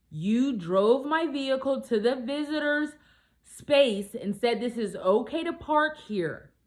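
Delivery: loud, high, slow demand to the guy; heated retold argument. Arguing with the guy at the tow yard, loud and high. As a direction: raise the voice and spell out his fault.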